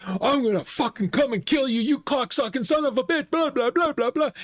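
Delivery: high-pitched mock tirade, not shouted; imitated angry rant. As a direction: imitate a furious caller spewing insults. Scammer's angry callback imitated.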